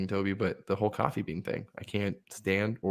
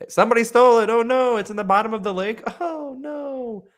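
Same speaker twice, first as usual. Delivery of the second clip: feigned distress; 14 semitones higher, 7 dB louder than usual. Pretend. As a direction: wail a badly faked alibi. Pretend alibi for sinking the car.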